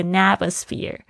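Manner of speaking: atmosphere starting with n, "natmosphere"; linked n. Shown as a demonstration. Teacher isolating the linked sound, linked n. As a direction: carry the n of "an" onto atmosphere. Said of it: "结尾的an又跟那个开头的a".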